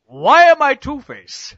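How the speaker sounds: slow, loud, stretched-out whine of confusion; mock bewildered outcry. Riffing as a confused villain, slow and loud. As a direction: drag it out like a lost villain.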